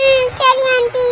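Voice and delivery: put-on high, slow small-boy voice; little child. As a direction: agree like a toddler just done crying. Consoled little boy answers aunty. (சின்னக் குழந்தைக் குரல்)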